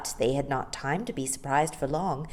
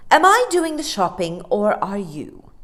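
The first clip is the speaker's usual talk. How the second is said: stern inquiry; 4 semitones higher, 9 dB louder than usual. Acted. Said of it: "Mary Poppins inquired".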